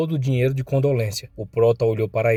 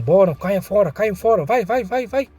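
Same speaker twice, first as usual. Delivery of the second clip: higher-pitched, hurried shooing; urgent ordering out; 8 semitones higher, 4 dB louder than usual. A narrator's reading. Thugs telling people to get out, urgent ordering. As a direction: drive them out in a raised pitch.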